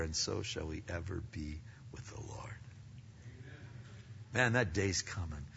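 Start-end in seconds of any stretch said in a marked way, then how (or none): 0.0-2.5 soft whispered promise; hushed whisper. Acted. Preacher whispering the promise of heaven to the congregation. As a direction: whisper it like a sacred secret.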